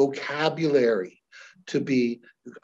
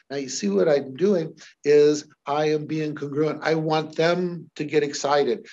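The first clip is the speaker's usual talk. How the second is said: normal talking voice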